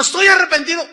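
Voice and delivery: lamento imitado, más alto y fuerte; arrepentimiento fingido. Retold or imitated. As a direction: exagera la pena falsa. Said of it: "Lloró y dijo". (imitando al hombre descubierto, pena exagerada)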